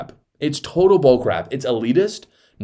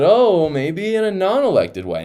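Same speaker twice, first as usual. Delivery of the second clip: mock coy; 8 semitones higher, 4 dB louder than usual. Mocks the supposed loophole.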